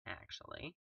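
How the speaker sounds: "actually" whispered, no voice. Real, not acted. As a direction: breathe "actually" softly. Repeated word, second whispered.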